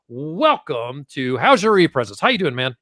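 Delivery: normal talking voice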